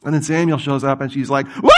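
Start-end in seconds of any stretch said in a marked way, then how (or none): 1.6-1.7 high, sudden mock scream; freaked-out scream. Preacher imitates medium seeing Samuel, mock terrified scream. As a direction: shriek like a startled medium. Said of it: "freaks out".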